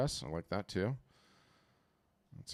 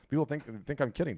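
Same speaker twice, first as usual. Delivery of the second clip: normal talking voice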